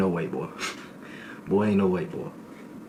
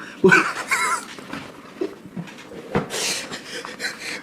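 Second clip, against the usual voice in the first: long high squealing laugh. Real, not acted. Laughing at the guard's dog threat.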